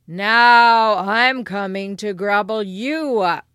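very loud, slow troll threat; roaring troll. Acted. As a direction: bellow the threat slowly as a troll. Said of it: "roared the troll".

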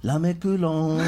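drawn-out mock French accent. Shown as a demonstration. Showing the accent is wrong, Spanish in mock French. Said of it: "It's French".

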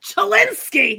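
high, loud Babs shout. Retold or imitated. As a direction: yell it as Babs. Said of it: "it kind of is the Babs voice".

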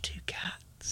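soft slow whisper. Pretend. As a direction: whisper it secretively. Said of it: "Why are you whispering it?".

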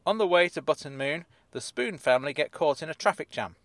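ordinary delivery

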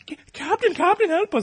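very high, put-on damsel voice; mock plea for help. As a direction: squeal for rescue, hammed up. Voicing Catherine begging for help.

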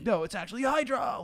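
high, loud, sarcastic announcement; mock plot reveal. Critic mocking a cookie-cutter spy plot, sarcastic.

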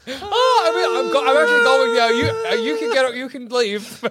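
high, laughing ghost farewell; overacted death scene. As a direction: ham up the ghost leaving.

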